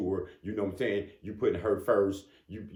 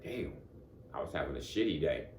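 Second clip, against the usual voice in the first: soft, lowered self-talk; quietly taken aback, playing it out; acted private aside; 5 semitones lower, 6 dB quieter than usual. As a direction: say it under your breath, as if to yourself. Acted private reaction to boss's praise.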